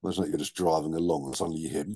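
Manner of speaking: usual speaking voice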